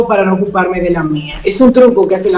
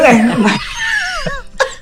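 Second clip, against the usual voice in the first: big shrieking laugh; 4 dB quieter than usual. Real, not acted. Hosts joking together, bursting into laughter.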